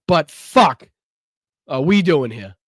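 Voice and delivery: a loud burst of frustration; loud exasperated swear; exasperated outburst. Real, not acted. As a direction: blurt it out, fed up. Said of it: "I promise I'm not always this, um, outlandish".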